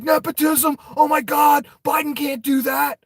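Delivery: high, shouted outrage like a panicked pundit; mock freakout. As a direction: shriek it like the media losing it. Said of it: "They'd freak the fuck out".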